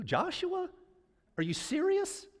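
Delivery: high-pitched disbelief; incredulous. Acted. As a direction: squeak it out as a baffled objection. Voicing people rejecting the nominee.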